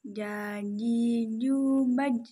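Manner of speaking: "ju, baj" said loudly and clearly; letter ja drill. Shown as a demonstration. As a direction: model the ja syllables.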